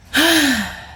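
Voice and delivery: loud, high exhaled sigh; big sigh. Real, not acted. Host pausing to wrap up the episode, big sigh. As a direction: let out a long sigh. Pause before wrapping up.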